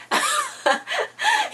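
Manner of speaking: high-pitched burst of laughter. Real, not acted. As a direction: crack up at the memory. Laughing at the memory.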